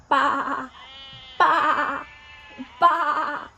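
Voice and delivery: loud wavering sheep baa; sheep bleat. Shown as a demonstration. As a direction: bleat like a sheep for little kids. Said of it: "What sound does the sheep make?".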